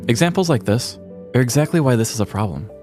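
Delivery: ordinary talk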